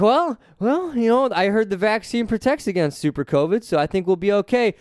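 smug, pretend-relaxed voice of the crowd; mock reassured person. Mocking people who trust the vaccine, hammed-up reassurance. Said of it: "And they're like".

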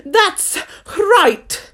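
high, loud royal shout; ringing high exclamation; shouted approval. A narrator's reading. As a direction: shout it out as an imperious queen. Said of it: "shouted the queen".

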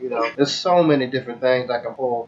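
normal talking voice

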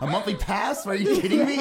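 high, laughing mock disbelief; retold indignation. Customer balking at a monthly pass, mock outraged. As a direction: act out amused, incredulous outrage. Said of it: "really mad at the guy".

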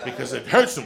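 ordinary talk

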